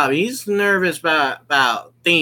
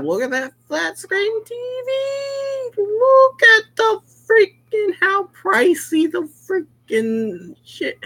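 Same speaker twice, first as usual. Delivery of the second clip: high squealing disbelief at the TV; amazed squeal. Real, not acted. Gamer gawking at the pricey flat screen, high amazed squeal. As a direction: shriek with wide-eyed wonder, much higher.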